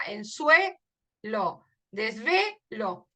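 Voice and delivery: "suelo" and "desvelo" stretched slowly, ending elo leaned on; slow rhyme demo. Shown as a demonstration. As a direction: drag out "desvelo" so the elo rings. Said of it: "Elo y elo". ("suelo", "desvelo", muy lentos, marcando elo)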